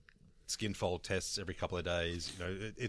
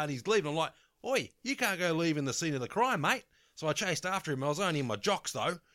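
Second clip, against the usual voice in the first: higher, louder Aussie bloke telling his tale; excited bogan witness; 6 semitones higher, 4 dB louder than usual. Retold or imitated. Neighbour in his jocks recounting the ram raid to reporters, excited bogan voice. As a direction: play the neighbour in his jocks gabbing to the news crew.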